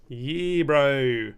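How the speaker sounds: loud New Zealand "Yee, bro"; hammed-up New Zealand bloke greeting; New Zealand accent. A mate greeting his mate in New Zealand accent. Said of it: "That's more New Zealand accent".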